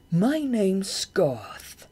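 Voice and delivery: very slow, drawn-out bat voice; the giant bat Goth introducing himself to Shade, slow and grand; big bat Goth, slow. A narrator's reading.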